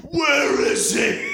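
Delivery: high slow drawn-out yell; Batman shout. Pretend. Cohost repeating the Batman bit as a slow high yell. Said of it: "do the voice of Batman".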